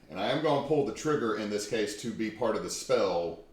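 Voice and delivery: ordinary delivery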